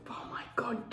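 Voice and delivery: hushed breathy whisper. Real, not acted. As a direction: drop to a whisper in disbelief. Whispered aside about age.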